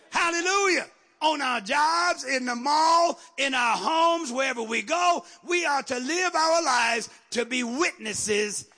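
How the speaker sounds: slow, high, ringing preacher's cadence; full-force preaching. Real, not acted. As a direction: stretch each phrase out and drive it home.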